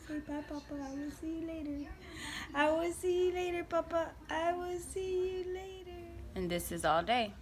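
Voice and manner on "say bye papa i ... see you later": put-on high baby voice; voicing the baby. Mother voicing the baby saying bye to papa, high. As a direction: wave bye to papa in a tiny voice.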